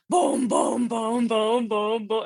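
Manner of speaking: steady, pounding booms; imitated slammed keys. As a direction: boom out hands slamming piano keys. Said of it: "and it goes".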